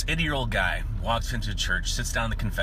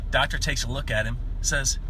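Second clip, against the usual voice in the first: ordinary talk, nothing marked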